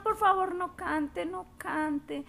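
high, hammed-up plea to stop the singing; mock pleading. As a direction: beg her comically not to sing. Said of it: "decían".

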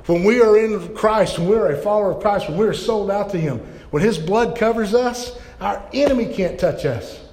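rally the church, pushing each line harder; full-force preaching. Acted. Preaching to the congregation.

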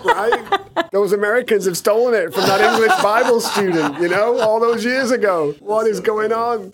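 high, laughing fake indignation; laughing mock outrage. Host joking Americans stole the declaration, high laughing mock outrage. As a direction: ham up the outrage through laughter.

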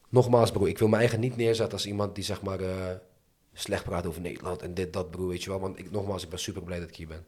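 usual speaking voice

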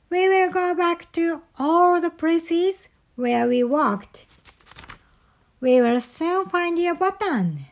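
high-pitched storybook Frog voice; helpful Frog. A narrator's reading. Frog promises Toad they will find the button, reassuring. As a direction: reassure a friend in a high character voice.

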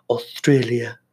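botched attempt at an Australian accent on "Australia"; failed Australian accent. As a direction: try an Australian accent and miss. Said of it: "that wasn't an Australian accent".

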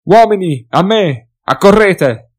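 high, loud rallying shout; battle yell. Acted. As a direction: call the men to arms. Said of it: "E lanciò un grido di battaglia simile a un ululato".

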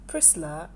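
soft "Priscilla" said as Prislah; lightly voiced pastor, gentle "Priscilla"; pastor's fond Priscilla. Pastor who calls her my daughter, tenderly. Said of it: "He says".

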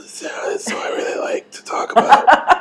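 high whispery cat voice. Pretend. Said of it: "I can do the podcast in cat voice".

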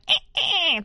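high squeaky dolphin chirps; chirpy clicking dolphin. Shown as a demonstration. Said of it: "That's my dolphin impression".

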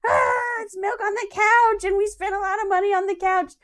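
hammed-up distress over spilled milk; exasperated. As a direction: wail it like a wronged homeowner.

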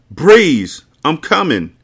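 high, loud call from afar. A narrator's reading. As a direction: shout her name from upstairs. Zaire calling her from upstairs.

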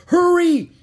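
high, loud urgent call; calling back. A narrator's reading. Annie running ahead to Jack, urgent shouted call. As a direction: yell back to someone lagging behind. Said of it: "Annie called back to him".

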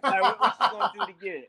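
amused and talking fast; laughing. Real, not acted. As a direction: say it through laughter. Joins shared laughter while teasing.

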